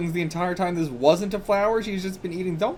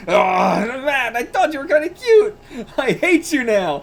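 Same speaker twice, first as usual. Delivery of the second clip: high, loud disappointed whine; 9 semitones higher, 7 dB louder than usual. Acted. Rejected boy whining at the girl, high and loud. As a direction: whine it out, pitched way up.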